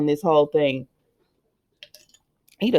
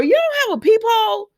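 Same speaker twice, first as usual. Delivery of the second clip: much higher, worked-up scolding; exasperated outcry. Real, not acted. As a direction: fret at the screen like she can hear you. Scolding Ava about opening door.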